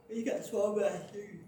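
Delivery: talking through a yawn; words pulled long by a yawn. Real, not acted. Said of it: "I quit yawning".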